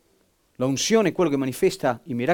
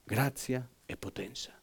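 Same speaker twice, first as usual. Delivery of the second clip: much softer, slow and reverent; hushed; 4 semitones lower, 14 dB quieter, slower than usual. Real, not acted. Preacher hushing the congregation in awe. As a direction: let it fall to an awed hush.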